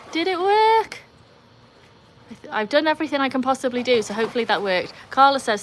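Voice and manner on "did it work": squeaky high, worried repeat of the question; high anxious repeat. Real, not acted. Streamer squeaks the question again at a high pitch. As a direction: pitch it way up in hope.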